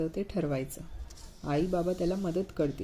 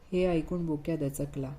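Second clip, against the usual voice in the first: plain reading voice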